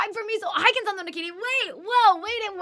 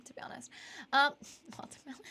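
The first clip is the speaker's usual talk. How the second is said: usual speaking voice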